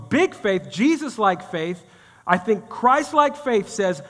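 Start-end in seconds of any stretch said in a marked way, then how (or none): none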